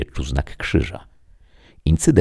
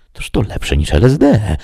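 mumbled; 7 semitones higher, 4 dB louder than usual. A narrator's reading. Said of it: "mruknął pod nosem".

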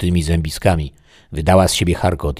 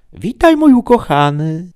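very high, loud eerie greeting; put-on high-pitched woman's call; ghostly beloved; 7 dB louder than usual. Dead lover greeting her beloved from the window, eerily sweet.

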